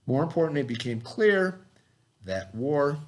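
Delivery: measured read-aloud to a class. Acted. Teacher reading the Fort Sumter story to the class, read-aloud. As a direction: read the text clearly to young students. Reads history text to class.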